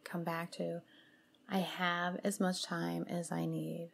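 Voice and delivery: soothing mantra-and-breath guidance, spaciously phrased; guided meditation. Acted. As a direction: lead them gently through the breathing, unhurried.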